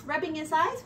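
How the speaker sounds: usual speaking voice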